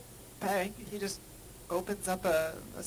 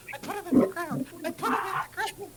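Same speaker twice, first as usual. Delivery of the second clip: high, faster whimpering chant; pitiful little man; 9 semitones higher, 11 dB louder, faster than usual. Retold or imitated. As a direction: repeat it, pitiful and rising. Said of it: "poor little man on the ground going".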